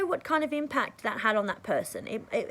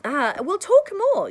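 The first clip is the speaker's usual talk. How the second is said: high, loud upbeat goodbye; happy farewell to a new friend, high and loud; cheery sign-off; 9 semitones higher, 8 dB louder than usual. Retold or imitated. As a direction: wrap up with bright enthusiasm. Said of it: "And I'll go".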